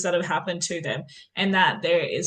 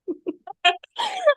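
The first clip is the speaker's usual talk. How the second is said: high burst of laughter; 4 dB louder than usual. Real, not acted. Host laughs at the answer.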